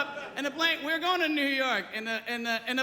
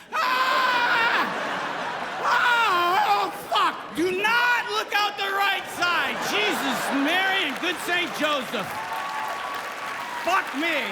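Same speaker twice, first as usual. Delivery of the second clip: high, loud scream into hollering; panicked pilot; 8 semitones higher, 4 dB louder, slower than usual. Acted. Pilot panicking over the PA to passengers, hammed-up screaming. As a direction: scream like a captain losing it. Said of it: "got on that P A and was like".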